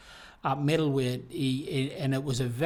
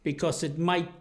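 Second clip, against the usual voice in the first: ordinary talk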